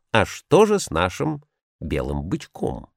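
ordinary delivery

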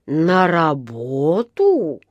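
slow, drawn-out incredulous question; he can't believe his ears; stretched disbelief; disbelieving question. A narrator's reading. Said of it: "не поверил своим ушам".

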